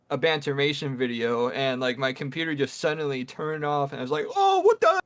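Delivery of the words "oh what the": high, loud startled yelp. Retold or imitated. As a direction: jump up in shock as the screen dies. Computer suddenly turned off.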